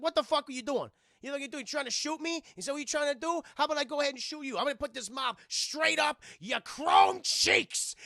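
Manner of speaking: high, loud acted tirade; angry threat. Streamer as Dick Miller threatening robot with mop, angry shouting.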